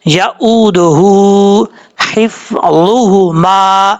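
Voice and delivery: slow chanted यऊदुहु हिफ्ज़ुहुमा with a heavy first ह and a thin second ह; slow Quranic recitation; tajweed demo. Shown as a demonstration. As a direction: chant it slowly with a heavy then light ह. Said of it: "इस तरीके से पढ़ना है".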